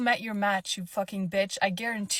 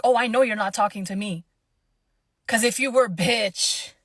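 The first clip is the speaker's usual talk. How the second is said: louder, biting put-down; cutting comeback; 8 dB louder than usual. Retold or imitated. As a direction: hit her back with contempt. Speaker confronts rude lady.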